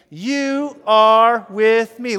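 very loud, long-held words; booming refrain. Shown as a demonstration. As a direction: belt it out word by word for the crowd. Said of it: "Say it again with me".

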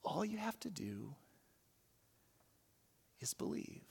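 soft whisper of the line. Shown as a demonstration. Pastor echoing the boy's line to the congregation in a whisper. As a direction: breathe the words like a secret.

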